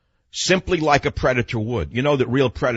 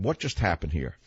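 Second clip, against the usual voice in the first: quiet; 6 semitones lower, 6 dB quieter than usual. Retold or imitated. Asking himself quietly.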